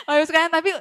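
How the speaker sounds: laughing. Real, not acted. Laughs after her punchline.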